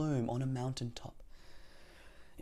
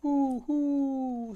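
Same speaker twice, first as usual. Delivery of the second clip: high, loud, drawn-out hoots; owl hoot; 12 semitones higher, 8 dB louder than usual. A narrator's reading. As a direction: call out like a storybook owl. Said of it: "hooted the owl".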